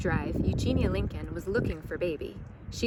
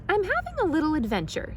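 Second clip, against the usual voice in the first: high, fast and delighted; Baby gleeful about her joyride; giddy; 10 semitones higher, faster than usual. Acted. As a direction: bubble over with glee. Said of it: "said Baby".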